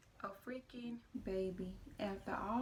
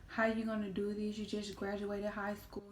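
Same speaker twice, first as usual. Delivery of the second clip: scolding mom; 9 dB louder than usual. Retold or imitated. Mom scolding about the pregnancy.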